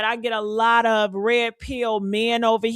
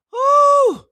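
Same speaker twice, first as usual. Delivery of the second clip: high, loud celebratory whoop; hype whoop; 13 semitones higher, 7 dB louder than usual. Real, not acted. Host whooping to fire up her viewers, loud and high. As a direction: let out a big excited woo.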